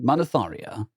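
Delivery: ordinary delivery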